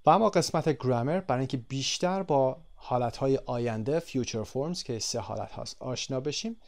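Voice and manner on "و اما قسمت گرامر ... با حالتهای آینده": usual speaking voice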